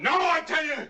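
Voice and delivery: high frantic shout. Acted. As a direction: scream the refusal. Killer refuses to hand over gun.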